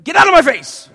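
loud, high angry outburst; angry snap. Retold or imitated. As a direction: bark it at the cheery friend. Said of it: "and you're just like".

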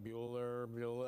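slow, low monotone roll call; lightly imitating a bored teacher droning; a hint of the Ferris Bueller teacher; Ben Stein drone. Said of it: "talks really slow".

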